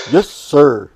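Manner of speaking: mock-salute with the voice, punched hard; sarcastic obedience. Boy told to do something by dad, loudly sarcastic. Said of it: "I'm pretty sure he's being sarcastic".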